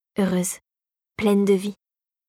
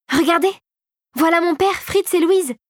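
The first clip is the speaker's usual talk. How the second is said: put-on high, eager girl's exclamation; excited calling; 7 semitones higher than usual. A narrator's reading. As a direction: point out loved ones with delight, pitch up. Clara spots her family.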